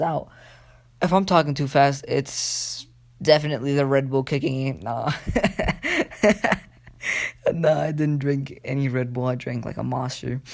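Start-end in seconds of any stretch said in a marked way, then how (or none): 5.2-6.6 high, giggly laugh at own joke; amused laugh. Real, not acted. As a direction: laugh it off, pitched up. Jokes about Red Bull.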